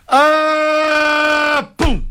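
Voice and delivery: long, high sustained falling cry, then a thud; queda de grande altura. Shown as a demonstration. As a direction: imitate a long plunge ending in a sudden thud. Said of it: "se o cachorro cair do décimo andar é". (grito longo de queda e baque)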